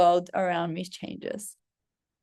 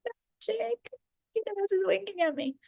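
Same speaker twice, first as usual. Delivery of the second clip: high, soft gushing over a lucky find; much higher and softer, thrilled and giddy; delighted squeal; 9 semitones higher, 6 dB quieter than usual. Retold or imitated. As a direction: squeal with glee like the shop winked at you. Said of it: "So then I'm like".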